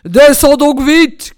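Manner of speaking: slow, booming impatient order; roaring tyrant; angry shouting. A narrator's reading. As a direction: bellow up at your wife. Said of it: "criait la Barbe-Bleue".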